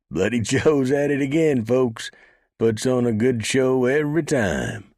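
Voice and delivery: very high, loud laughing bellow. A narrator's reading. Mule driver bellowing to folks in amusement. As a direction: holler it to the whole street. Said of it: "The driver bellowed and laughed".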